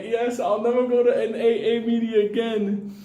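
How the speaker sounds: high, odd put-on rooster voice; drunk rooster. Comic voicing the boozed-up rooster to the crowd, high put-on voice. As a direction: play the rooster as a happy drunk. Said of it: "The rooster's like".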